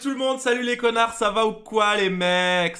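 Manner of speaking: high, loud drawn-out hello to the chat; loud hyped greeting. Real, not acted. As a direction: shout a hyped greeting, stretching it out.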